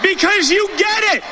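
slow, high, shouted rally cry. Real, not acted. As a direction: rouse the crowd, big and slow. Rally speech, building to crowd cheer.

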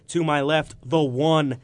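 ordinary talk

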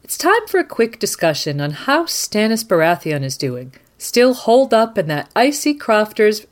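normal talking voice

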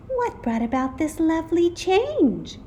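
high-pitched pleased question; sweet teacher. A narrator's reading. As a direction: ask the class sweetly, up high. Sweet teacher asks the class.

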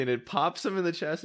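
ordinary delivery

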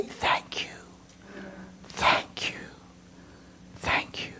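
hushed, reverent whisper; whispered thanks. Pretend. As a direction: breathe the thanks softly. Whispered thanks before the cross.

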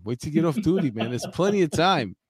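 normal talking voice

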